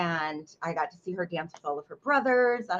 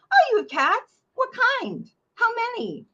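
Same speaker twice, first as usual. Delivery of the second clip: much higher, loud fake-chirpy questions; eager spouse; 6 dB louder than usual. Spouse cutting off the mother, high chirpy interest. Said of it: "my beautiful spouse would go".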